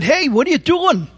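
sudden high panicked cry; alarmed shout. Retold or imitated. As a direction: yelp it as the plane dives. Plane goes into a nosedive.

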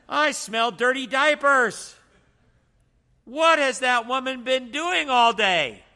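the husband's temper climbs as he walks in and smells diapers; getting upset. Retold or imitated. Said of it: "And now he's upset".

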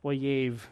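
Oyev said slowly as OY-yev, two syllables; Hebrew word oyev. Shown as a demonstration. Preacher teaching the congregation the Hebrew word, careful pronunciation. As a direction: pronounce Oyev with care, OY then yev. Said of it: "It's the word oyev".

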